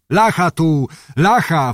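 mock shout of the crowd of chiefs; calling out. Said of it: "wołali kurzeniowi".

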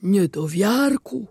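slow, weighty reproach; slow disappointed genie chiding the fisherman; reproachful genie. Acted. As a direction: draw out the rebuke slowly. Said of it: "rzekł geniusz".

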